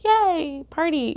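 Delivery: high squeaky yay party, half-hearted; imitating a tipsy party crowd; mock party cheer. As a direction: pitch it way up like a giddy party girl.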